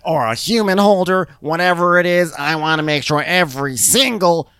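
angry yelling. Retold or imitated. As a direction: rant at the whole kitchen. Meredith yelling at pot holder.

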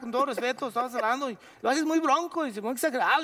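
high rough imitation of the boxer Julio; Julio Chávez impression. Telling the actor how he talks, imitating the boxer. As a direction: imitate Julio's rambling slurred talk. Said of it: "yo no hablo así".

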